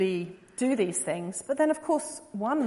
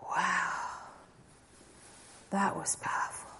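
stunned hushed; 3 semitones lower, 9 dB quieter than usual. Retold or imitated. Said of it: "he just went".

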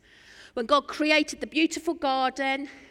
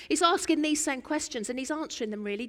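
ordinary talk, nothing marked

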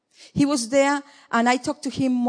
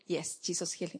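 hushed prayer; 5 semitones lower, 15 dB quieter than usual. Retold or imitated. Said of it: "sit down, and say".

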